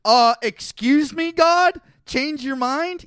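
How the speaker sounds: high, loud, drawn-out challenge; mock incredulous. Reader challenging God on changing his mind, mock incredulous. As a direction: call God out in mock disbelief.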